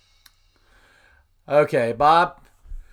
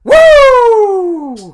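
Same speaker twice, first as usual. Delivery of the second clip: much higher, much louder Ric Flair woo; 13 dB louder than usual. Retold or imitated. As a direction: let rip a long, piercing Flair-style woo. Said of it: "Ric Flair said that".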